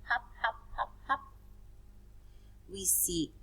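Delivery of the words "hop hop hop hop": bouncy hops. Retold or imitated. Teacher mimics bunnies hopping.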